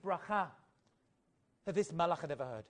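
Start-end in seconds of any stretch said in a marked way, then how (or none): none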